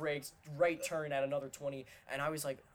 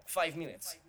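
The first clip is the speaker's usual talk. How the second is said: put-on higher, a light Russian accent; Russian driver impression; 5 semitones higher than usual. Quoting the Russian Uber driver to friends, light Russian accent. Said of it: "stops mid-sentence and goes".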